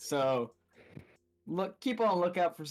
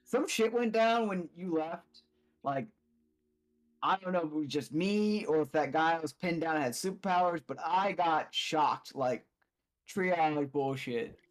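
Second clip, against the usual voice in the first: slow; slower than usual. Acted. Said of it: "Like, "All right, cool".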